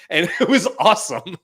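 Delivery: laughing through the words, high and loud; real laugh bubbling up into the words. Real, not acted. Recalling last night's fun with his wife, laughing.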